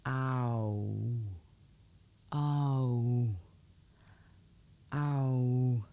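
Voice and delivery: ow drawn out, gliding slowly from "ah" to "oo"; slow-motion diphthong demo on ow; really slow. Shown as a demonstration. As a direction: stretch each ow into a long glide. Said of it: "When I do it really slow".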